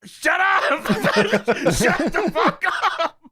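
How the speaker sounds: high, loud mock yell, laughing; Shane yelling. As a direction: bellow at him to shut up. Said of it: "Shane Douglas saying".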